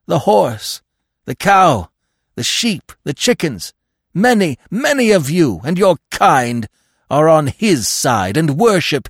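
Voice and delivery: high, loud, fervent tirade; frenzied devotion. A narrator's reading. Dog listing his master's followers, high frenzied devotion. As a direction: pile up the list with fanatic force. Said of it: "a frenzy of devotion".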